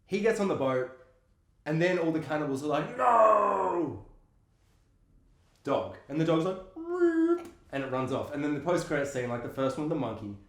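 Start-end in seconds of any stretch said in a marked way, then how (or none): 3.0-4.0 long drawn-out mock howl, pitched up; cannibals' thwarted cry. Imitating cannibals as boat escapes. 6.8-7.5 very high little dog yelp; dog whine. Retold or imitated. Imitating the dog's reaction.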